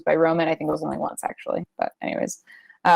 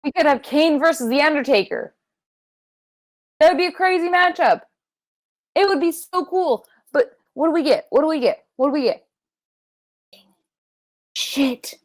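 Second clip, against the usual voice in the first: exasperated rant; 8 semitones higher than usual. Real, not acted. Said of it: "makes me so mad".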